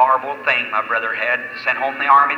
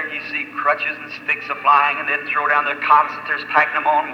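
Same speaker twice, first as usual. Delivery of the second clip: normal talking voice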